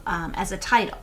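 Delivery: ordinary delivery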